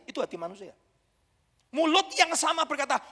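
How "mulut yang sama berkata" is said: normal talking voice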